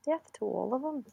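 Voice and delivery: soft Jersey-accent retry. Shown as a demonstration. Testing the accent on co-host, soft Jersey accent. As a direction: try the Jersey vowels quietly. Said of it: "It's like a Jersey accent".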